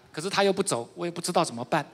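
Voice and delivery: ordinary delivery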